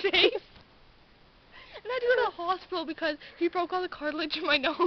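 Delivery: usual speaking voice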